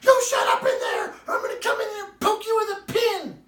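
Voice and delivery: loud furious mother's yell; angry shout. Retold or imitated. Mother yelling through the door at the child. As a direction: threaten at the top of your lungs.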